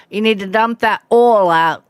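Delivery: oil said with a Southern drawl; a hint of a Missouri dad's drawl on oil. Daughter imitating her dad's Southern drawl on oil.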